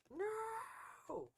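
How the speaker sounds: very high, piercing scream. A narrator's reading. Mother screams over her son's crumpled form. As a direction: scream his name in horror. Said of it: "she screamed".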